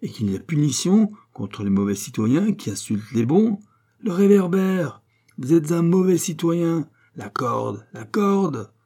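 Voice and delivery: mock-judicial taunt rising to cries; acted ironic denunciation; sinisterly ironic. A narrator's reading. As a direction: pass sentence with menacing irony. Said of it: "rire d'une façon sinistrement ironique".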